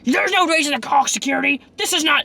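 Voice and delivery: put-on high, indignant drunk protest; a hint of a slurring drunk denying it; drunk woman. Drunk passenger protesting to the bus driver. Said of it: "and she's like, as drunk".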